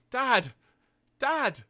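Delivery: high, loud cry of a distressed son; hammed-up boy calling for his father; Daniel. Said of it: "Daniel is just kind of standing nearby".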